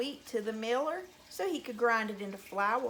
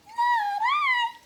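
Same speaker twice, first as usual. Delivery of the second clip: very high, loud squeak; squeaky mouse; 8 dB louder than usual. A narrator's reading. Mouse refusing to help the hen, high squeaky voice. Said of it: "said the mouse".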